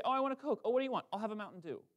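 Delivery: a hint of a Florida accent; Florida talk. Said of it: "They say".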